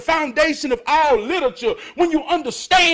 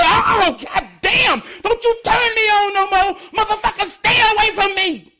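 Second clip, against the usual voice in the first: mock panic, backing off in a hurry; panicked quote; 5 semitones higher than usual. Imitating a scared person who won't be turned on. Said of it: "And they say".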